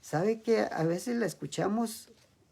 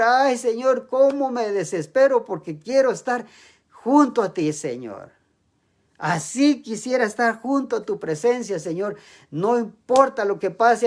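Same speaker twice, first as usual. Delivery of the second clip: loud, higher pleading prayer to the Lord; fervent prayer; 4 semitones higher, 5 dB louder than usual. Acted. Preacher acting a believer praying loudly to the Lord, fervent and pleading. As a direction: cry out to God like you can't bear to be apart.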